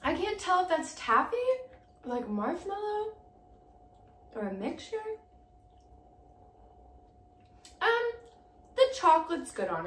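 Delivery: talking while chewing candy; chewy sticky mouthful; mouth full, chewing. Real, not acted. Seen 2: Holds bitten candy piece near mouth, jaw working, chewing expressions throughout.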